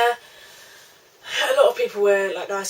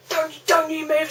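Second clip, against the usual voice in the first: slowed, higher mock scream of the teacher; mild mock yell; 7 semitones higher, slower than usual. Teacher screaming at the chewing pupil, a mild mock yell. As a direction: bark the order like the scary teacher. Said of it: "screamed at me".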